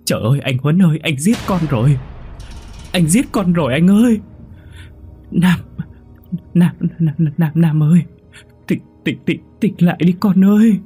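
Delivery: stammered, then high, loud wailing; stammering panic into wailing. A narrator's reading. Mother realising her son is dead, stammering then wailing. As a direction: stutter in terror, then break into a wail. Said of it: "Cô Hảo lắp bắp gọi chú Huấn".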